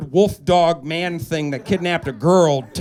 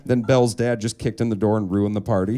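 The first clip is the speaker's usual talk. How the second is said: normal talking voice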